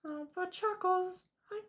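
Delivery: very high squeaky cartoon character; squeaky Bitsy voice. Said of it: "you sound like a squeakier Mickey Mouse".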